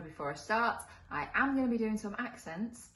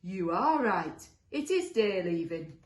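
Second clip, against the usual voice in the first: amazed monster; 7 dB louder, slower than usual. Acted. Second monster amazed, concedes the argument.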